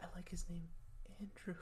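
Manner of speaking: soft whispered crush, tipping into laughter; mock swooning whisper. Host playing a librarian smitten with him, whispering. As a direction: whisper the admiration, giggling at the end.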